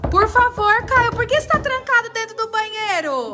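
high, loud pleading call. Real, not acted. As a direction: plead with him through the door. Mother pleading at locked door.

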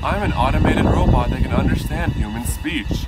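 stiff, mechanical automated voice; robot voice. Retold or imitated. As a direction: recite it like a phone bot. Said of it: "It was like a robot talking to you".

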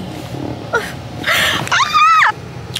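high shrieking burst of laughter. Real, not acted. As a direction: laugh out loud, high and shrill. Hysterical laugh after joke.

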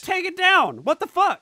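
angry shout. Pretend. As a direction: yell it as a furious boss. Mock Nintendo exec outrage.

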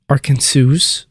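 Arkansas ending in "sooz"; wrong pronunciation. Shown as a demonstration. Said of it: "It is not Arkansas".

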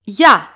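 YA said as a single syllable, loud; "Ya" with its vowels pronounced together; one-syllable ya. Shown as a demonstration. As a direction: glide straight into the a of "Ya". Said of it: "they are pronounced together".

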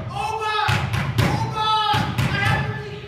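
very high, loud calling out; high, loud urgent yell; shouting at the door. Acted. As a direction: pound on the palace door and shout for the king. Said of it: "he knocked on the door".

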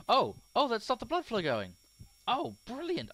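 high, loud thrilled yelling; excited shout. Real, not acted. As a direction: shout it out in sudden delight. Surgery game, sudden success.

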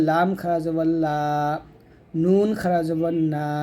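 letter drill with "লা" and "না" held long; one-alif drawn-out vowel. Shown as a demonstration. Teacher drills letters for students, drawn-out "লা" and "না". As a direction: spell each, then stretch "লা" and "না". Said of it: "এক লিফ পরিমাণ টান দিবেন".

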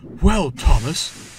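loud, drawn-out, cross engine voice; fuming. A narrator's reading. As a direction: draw it out, seething. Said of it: "he fumed".

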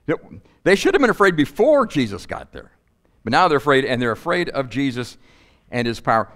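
usual speaking voice

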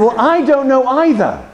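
high, emphatic comeback; retold retort. Teacher answers unhelpful boy, high retold retort.

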